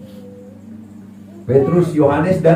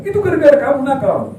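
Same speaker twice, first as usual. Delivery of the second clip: mock scolding. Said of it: "Saya bilang".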